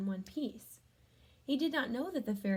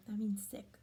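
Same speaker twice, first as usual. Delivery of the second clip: whisper; 2 semitones lower, 4 dB quieter than usual. Real, not acted. Aside explaining word to children.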